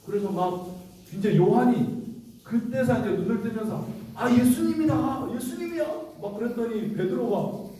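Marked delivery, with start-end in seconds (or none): 4.2-5.9 high-pitched exclamation of sudden recognition; excited recognition. Acted. John calling out on recognizing Jesus, excited and high. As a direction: act out a thrilled, high-voiced realization.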